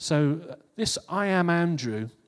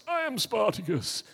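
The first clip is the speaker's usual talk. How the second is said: "I am Spartacus" lifted higher, like a second voice; higher echo; 7 semitones higher than usual. Retold or imitated. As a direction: echo it up high as another man. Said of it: "So you think about Spartacus".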